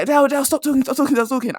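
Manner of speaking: high, urgent repeated plea to stop; boss cutting her off. Retold or imitated. Boss to Adele mid-argument, a high urgent stop order. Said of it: "My boss is like".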